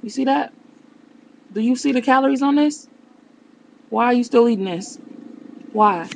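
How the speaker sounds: loud, high outraged scolding; exasperated. Real, not acted. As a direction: call her out in disbelief. Scolding mom about calories.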